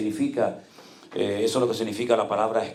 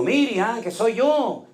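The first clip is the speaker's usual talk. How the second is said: pleading with daughter; 11 semitones higher, much slower than usual. Retold or imitated. Father answering his confused daughter.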